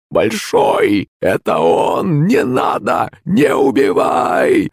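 put-on panicked hoarse old crone, slow; wheezing old woman. A narrator's reading. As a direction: plead in a rasping old woman's cry. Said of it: "захрипела бабка".

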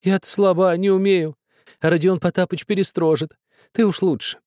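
high, begging woman's voice; pleading woman. A narrator's reading.

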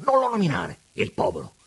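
loud indignant scolding; angry Pigna. A narrator's reading. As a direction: put him in his place, outraged.

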